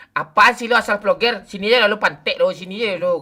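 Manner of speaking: loud angry yelling; shouting. Real, not acted. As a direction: yell at him. Yelling at friend in game.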